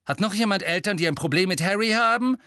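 high, loud challenge rising to the end; combative. A narrator's reading. Ron challenging the other roommates, combative. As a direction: dare anyone to object. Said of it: "sagte er angriffslustig".

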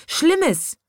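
very high, alarmed cry; Schrei der Tiere. A narrator's reading. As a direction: cry it out as a high-pitched exclamation. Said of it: "schrien die Tiere". (hoher, erschrockener Aufschrei)

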